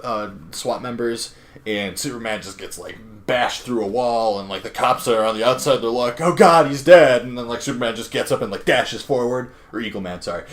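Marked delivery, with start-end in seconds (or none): 6.2-7.2 panicked cry. Retold or imitated. Cops' panicked outcry.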